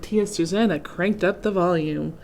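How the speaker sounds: ordinary delivery, nothing marked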